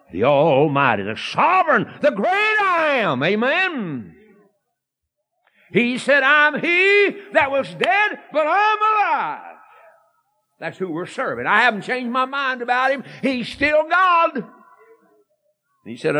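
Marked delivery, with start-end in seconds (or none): 1.0-9.6 high, building preacher's cry; preacher crying out God's words; preaching at full force. Real, not acted. 11.5-14.4 preacher declaring his faith; preaching crescendo. Real, not acted. Preaching climax.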